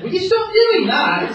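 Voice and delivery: put-on high, loud, exasperated girl voice; annoyed Jill. Jill scolding Jack for falling down, high and exasperated. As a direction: squeal it at Jack, fed up.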